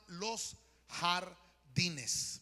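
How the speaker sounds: very slow, syllable by syllable; drawn-out title. Real, not acted. Preacher announcing his theme to the congregation, very slow and deliberate. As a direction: spell out the theme slowly with pauses.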